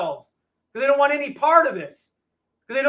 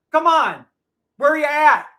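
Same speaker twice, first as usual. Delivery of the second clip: higher, louder taunt at the critics; taunting call-out; 4 semitones higher, 6 dB louder than usual. Real, not acted. Streamer daring critics to debate him, loud taunt.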